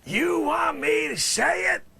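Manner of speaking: comic witness shouting back; shouted question. Acted. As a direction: yell it indignantly.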